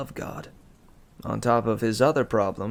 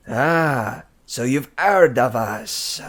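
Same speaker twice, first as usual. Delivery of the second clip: put-on French accent; 2 semitones higher, 8 dB louder, slower than usual. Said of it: "He, he had a French accent".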